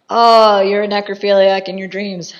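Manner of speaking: loud, drawn-up "oh" of mock dismay; mock exclamation. Reviewer reacting to the priest's dream, loud mock outburst. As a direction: blurt it out loudly, easing off towards the end.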